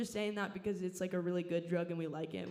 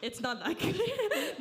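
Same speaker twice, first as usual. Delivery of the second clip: mock audience reply; 11 semitones higher, 6 dB louder, slower than usual. Mock heckler answer, squeaky.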